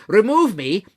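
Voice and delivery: high, loud desperate cry; exclaimed. A narrator's reading. Scrooge cries to the ghost to take him away, loud and desperate. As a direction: burst out begging. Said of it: "Scrooge exclaimed".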